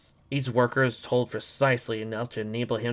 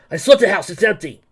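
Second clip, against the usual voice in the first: loud report; 7 semitones higher, 9 dB louder than usual. Acted. Hero reports after search.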